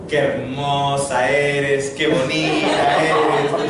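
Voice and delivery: slow, tender reading of a love note. Retold or imitated. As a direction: read it out slowly like a sweet note. Love note written on the paper.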